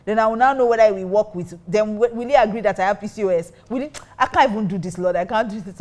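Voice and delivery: retelling how she panicked and argued with God; frantic protest. Retold or imitated. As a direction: plead with God, flustered and overwhelmed. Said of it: "I was just going on and on".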